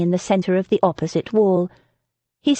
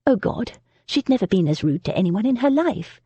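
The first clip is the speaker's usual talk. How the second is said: ordinary delivery, nothing marked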